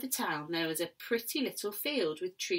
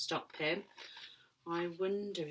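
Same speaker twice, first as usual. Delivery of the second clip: plain reading voice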